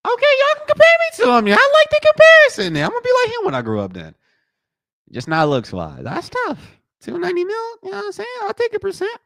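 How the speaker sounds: squeaky, very high, fast; giddy high voice. Real, not acted. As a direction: falsetto excitement over money. Squeaky excited voice about net worth.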